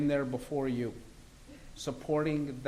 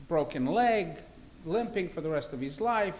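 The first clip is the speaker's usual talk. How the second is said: ordinary talk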